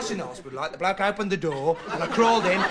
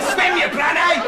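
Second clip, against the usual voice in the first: louder, higher, slow yell; mock shout; 9 semitones higher, 6 dB louder, slower than usual. Rescuers shouting at a drowning man, loud mock yell. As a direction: bellow it slowly at the swimmer. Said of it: "they just shout".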